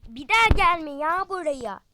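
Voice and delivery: loud, sneering; scornful telling-off. Acted. Friend at the cafe telling the broke girl off loudly. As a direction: push her away with contempt.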